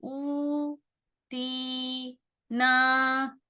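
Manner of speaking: "ऊ", "ती", "ना" each sustained on a steady note; stretched syllables. Shown as a demonstration. Teacher drilling how far to pull each, long vowels. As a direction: hold "ऊ", "ती", "ना" two beats apiece, paused between. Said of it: "खींचना कितना है".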